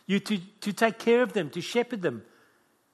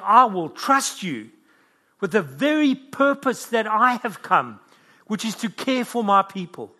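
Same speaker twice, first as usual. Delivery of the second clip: raised, loud, deliberate preaching; higher, forceful words of Jesus; solemn commission; 4 semitones higher, 7 dB louder than usual. Acted. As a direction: entrust the task with slow, emphatic force. Preacher voices Jesus commissioning Peter.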